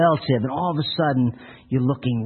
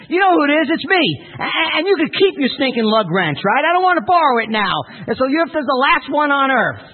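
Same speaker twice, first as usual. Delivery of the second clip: furious shout; 10 semitones higher than usual. Retold or imitated. City man shouting at the farmer, furious. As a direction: yell it out, fed up and soaked. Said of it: "the man called out".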